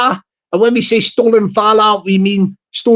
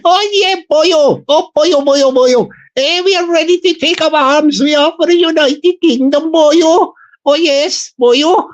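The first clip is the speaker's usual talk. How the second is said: mock Welsh; 5 semitones higher than usual. Streamer voicing a Welsh recruit, hammed-up Welsh lilt. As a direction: ham up a Welsh volunteer, boyo. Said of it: "we call them the Taffies".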